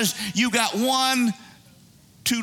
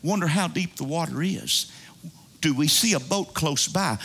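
low, contained self-calming voice; nervous self-talk; 6 semitones lower, 3 dB quieter, faster than usual. Acted. Boater thinking to himself as the boat leaks, mildly nervous. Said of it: "you get a little nervous, and you're thinking".

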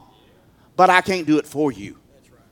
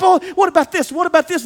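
mock worry; 12 semitones higher, 6 dB louder than usual. Imitating an anxious worrier.

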